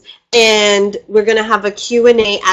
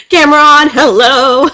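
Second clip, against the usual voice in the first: louder, higher, beaming hello; excited camera reveal, laughing into it; bright greeting; 6 semitones higher, 6 dB louder than usual. Real, not acted. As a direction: announce it to the room with delight. Cheerful camera-on greeting to viewers.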